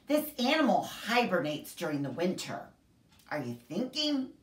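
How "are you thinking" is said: usual speaking voice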